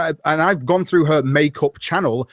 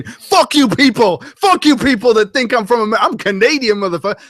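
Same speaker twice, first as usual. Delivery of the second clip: mock triggered rant; 5 semitones higher, 5 dB louder than usual. Pretending Andy rages at being called American, mock fury. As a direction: rant like an offended YouTuber, hammed up. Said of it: "you got a triggered video going".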